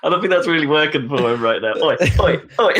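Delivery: half-laughing remark; wry amused aside; amused commentary. Retold or imitated. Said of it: "And I'm just sat there going".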